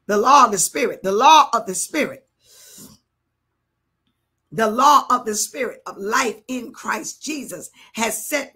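forceful scripture proclamation, loud opening; preaching. Real, not acted. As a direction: declare the verse with rhythmic conviction.

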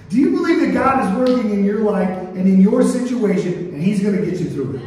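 preacher drilling the church with rising questions; call and response preaching. Acted. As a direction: pump up the room with each question. Preacher calls for congregation answers.

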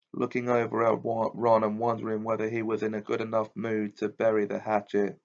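ordinary delivery, nothing marked

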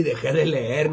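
ordinary talk

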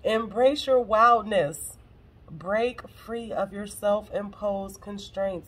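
slow, deliberate delivery, loud on "Embrace your wildness"; emphatic re-reading. Real, not acted. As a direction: declare "Embrace your wildness" loudly, then draw out each word. Emphatic re-read of card.

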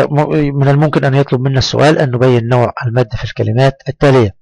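ordinary delivery

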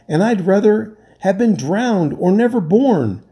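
enraged castaway shaking the sailors; furious rant. A narrator's reading. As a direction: hammer the warning home. Enraged man rebukes sailors.

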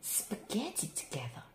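usual speaking voice

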